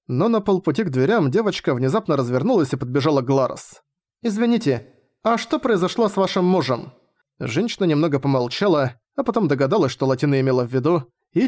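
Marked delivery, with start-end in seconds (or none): 4.2-6.9 put-on lightly higher child voice; a small curious girl asking; young girl voice. (голос маленькой девочки)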